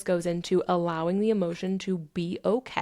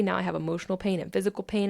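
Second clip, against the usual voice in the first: ordinary talk, nothing marked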